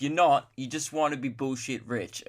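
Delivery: ordinary delivery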